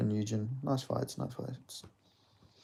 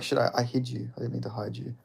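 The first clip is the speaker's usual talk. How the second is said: ordinary delivery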